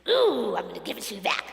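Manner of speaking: mock-angry outburst, starting loud; angry and nasty. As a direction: act out an urge to lash back. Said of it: "angry and nasty and".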